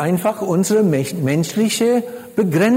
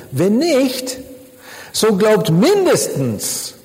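"mindestens" stretched slow and higher; emphatic plea; 4 semitones higher, slower than usual. Acted. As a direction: lean hard on "mindestens", drawing it out. Reads Jesus urging them emphatically. (eindringlich, Betonung auf „mindestens“)

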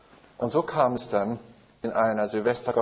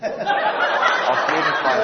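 laughs through the words, drawn out slowly; amused at his own joke, laughing it out; 5 semitones higher, 6 dB louder, much slower than usual. Real, not acted. Preacher laughing at his own short sermons, laughing.

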